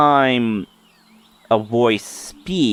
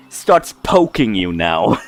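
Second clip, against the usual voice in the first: usual speaking voice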